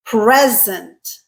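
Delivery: "Present" as PREZ-ent, falling; stress on first syllable. Shown as a demonstration. Teacher repeats the noun, "Present" stressed on PRES. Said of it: "pronounce the same word this way".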